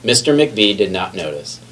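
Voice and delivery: ordinary delivery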